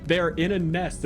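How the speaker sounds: usual speaking voice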